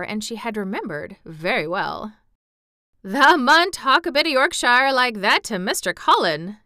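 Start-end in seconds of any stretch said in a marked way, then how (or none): 3.0-6.6 Dickon's amused broad Yorkshire; pleased lad teasing in Yorkshire; chuckling Yorkshire. A narrator's reading. Dickon encouraging Mary, amused Yorkshire. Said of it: "Dickon chuckled".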